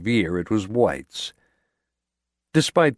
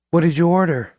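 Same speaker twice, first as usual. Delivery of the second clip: higher, louder eager woman's voice; young woman voice; 9 semitones higher, 5 dB louder, faster than usual. A narrator's reading. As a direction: lift into her curious question. Diane asks about food.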